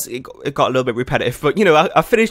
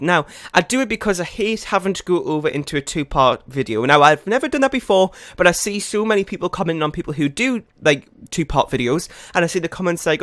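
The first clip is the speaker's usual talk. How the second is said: normal talking voice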